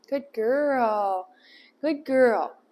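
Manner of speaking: normal talking voice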